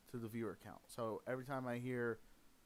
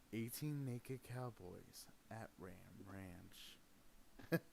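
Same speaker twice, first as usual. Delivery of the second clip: low slow voice; 6 semitones lower, 8 dB quieter, much slower than usual. Pretend. Quoting a joke song.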